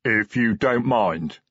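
slow troll adding a courtesy; drawn-out, deferential troll voice; troll, belatedly polite. A narrator's reading. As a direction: plod out an afterthought as a troll. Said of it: "awareness of his position dawning on him".

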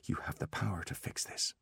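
hushed, near-whispered self-talk; quietly steady yourself; soft, private resolve; self-soothing undertone. A narrator's reading. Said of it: "he told himself".

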